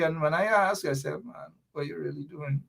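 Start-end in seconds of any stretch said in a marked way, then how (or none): 1.2-2.6 much softer, quick confiding question; hushed aside. Retold or imitated. Asking his doctor relative a secret, quick hushed question. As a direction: toss it off low and fast, half-joking.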